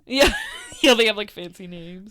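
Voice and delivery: real amused laugh. Real, not acted. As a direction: say it while laughing. Laughing at cryptid hunters.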